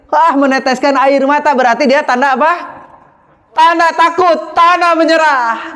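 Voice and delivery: high, rising rhetorical questions; eager preacher questioning the audience; rhetorical excitement. Acted. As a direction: lift the voice and quiz the crowd. Preacher asking the crowd rhetorically.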